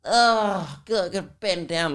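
put-upon private school brat, high drawn-out groans; bratty whining complaint. Retold or imitated. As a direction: groan like every tiny effort is torture. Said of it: "she'd be like".

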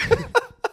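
a short high cackling laugh; high cackle. Real, not acted. Laughing after quoting Jared, high cackle. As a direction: cackle at the punchline.

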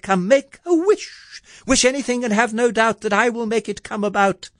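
very high, eager fairy; high-pitched fairy godmother proclamation; fairy's cry. A narrator's reading. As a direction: rattle off the promise in a high voice. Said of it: "she cried".

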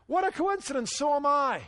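high-pitched, eager discovery of common ground; mock delighted surprise. Rescuer to man on the bridge in a joke, mock delighted.

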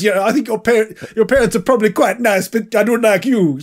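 mock-apologetic line in a put-on English accent; hint of English accent. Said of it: "Sorry about the accent".

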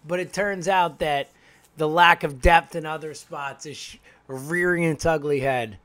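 normal talking voice

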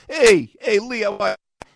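loud, high Jersey Italian greeting; big brash Jersey Italian hello. Retold or imitated. [name] greets [name] on his show in a loud Jersey Italian voice. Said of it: "I was talking like this".

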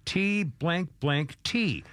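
slow, deliberate T blank blank T; spelled out slowly. Shown as a demonstration. Host spelling out a censored word for listeners, slowly. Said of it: "it's a four-letter word".

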